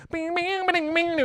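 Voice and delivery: high plinky bing noises; mock guitar plinking. Mimicking a bland guitar solo.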